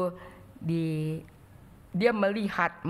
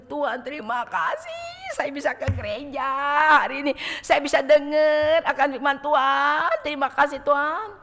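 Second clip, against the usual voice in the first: grateful praise; 6 semitones higher, 7 dB louder than usual. Retold or imitated. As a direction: let the thanks swell up joyfully. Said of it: "Kita berkata".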